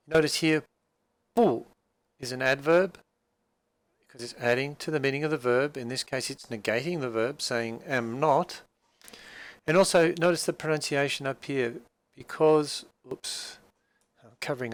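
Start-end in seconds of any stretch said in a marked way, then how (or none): none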